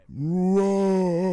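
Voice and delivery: high, drawn-out incredulous bro; a pleading, fed-up aside; exasperated bro. Real, not acted. To the audience, a high fed-up bro.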